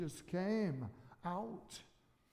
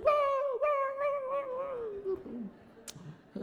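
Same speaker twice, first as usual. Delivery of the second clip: put-on wife hearing the baby news: squealing whoas that fade; imitating wife's joy; much slower than usual. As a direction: mimic the wife's thrilled squeal, trailing off. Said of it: "And Ann's like".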